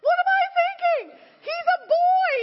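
high, loud amazed squeal of a mother; shocked excited squeal. Retold or imitated. Mother squealing at her baby's backflips, very high and loud. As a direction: shriek it, thrilled and stunned.

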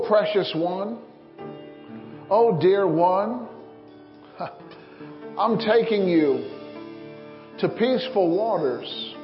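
normal talking voice

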